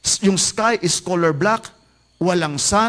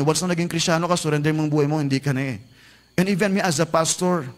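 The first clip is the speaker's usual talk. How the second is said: usual speaking voice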